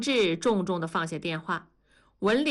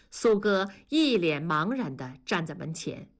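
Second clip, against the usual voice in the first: ordinary delivery, nothing marked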